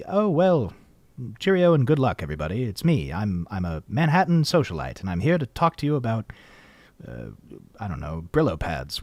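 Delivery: mock Mid-Atlantic accent of an old ad. Shown as a demonstration. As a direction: ham up a Mid-Atlantic Manhattan socialite. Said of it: "what's called the Atlantic accent".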